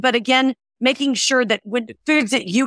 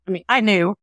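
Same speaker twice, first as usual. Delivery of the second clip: ordinary talk, nothing marked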